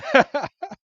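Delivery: high, loud peal of laughter; bright quick run of giggles. Real, not acted. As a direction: burst out laughing, amused. Real laugh after the line.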